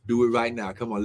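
ordinary talk

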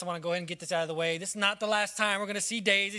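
ordinary talk

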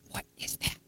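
put-on a hushed child's whisper to mom; a scared little whisper in church; whispered question. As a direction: breathe it out so nobody in the pew hears.

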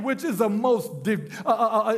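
ordinary talk

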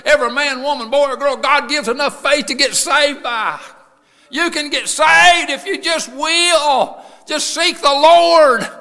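high, shouted sermon cry; full-force preaching. Real, not acted. Preaching about blind men and Jesus, full-force shout. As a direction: preach it loud and high to the whole church.

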